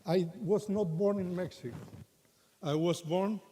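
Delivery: ordinary talk, nothing marked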